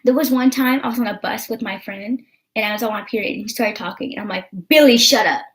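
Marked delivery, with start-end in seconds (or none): 4.7-5.4 snapping. Retold or imitated. Retelling snapping at friend on bus.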